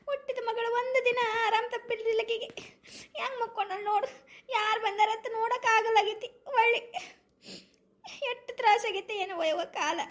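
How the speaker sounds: high-pitched acted crying; tearful lament. Mother laments her injured daughter's suffering, crying. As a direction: wail the words out between sobs. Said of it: "ಏನಕ್ಕೆ ಅಳ್ತಿದ್ದೀರಾ?".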